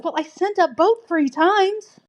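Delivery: high, slow, put-on God voice for the punchline. God's punchline voice in joke.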